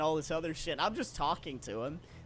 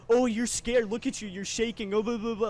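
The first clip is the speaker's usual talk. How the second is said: mocking a drunk heckler, higher and louder; retold taunt, pitched up; hostile taunting; 5 semitones higher, 4 dB louder than usual. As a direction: imitate someone goading for a reaction. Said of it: "trying to get a reaction out of me".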